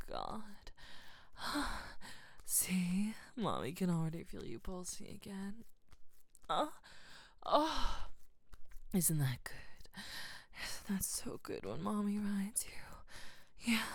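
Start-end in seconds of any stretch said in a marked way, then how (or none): none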